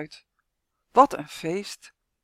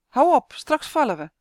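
loud, higher warning call; girl calling out as the chair wobbles; 6 semitones higher, 6 dB louder than usual. A narrator's reading. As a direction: shout a warning to the dog. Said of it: "roept San".

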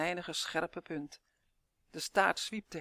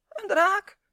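schel hoog en luid gepiept van schrik; piepend; 14 semitones higher, 11 dB louder than usual. Acted. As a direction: piep het uit als een doodsbang elfje. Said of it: "piepte Uf". (Uf piept doodsbang naar de draak)

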